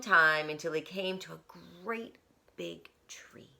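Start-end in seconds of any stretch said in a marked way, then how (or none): 1.8-3.5 whisper. A narrator's reading. Hushed reveal.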